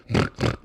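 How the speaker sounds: two imitated pig snorts; voiceless piggy snorting noise. Shown as a demonstration. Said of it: "sounds like a pig".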